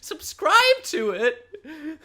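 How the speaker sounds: high, whiny, begging pleading. Pretend. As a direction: beg like a whining child. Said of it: "I'll stop with the whiny voice".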